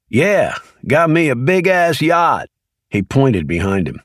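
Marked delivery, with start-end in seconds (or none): 0.1-2.5 higher, slow, deadpan mock boast; comical sarcasm. A narrator's reading. Leroy mocking the agents about owning a yacht, slow sarcastic boast. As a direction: sell the joke slowly, tongue in cheek. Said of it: "with a comical expression".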